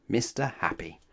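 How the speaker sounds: ordinary delivery, nothing marked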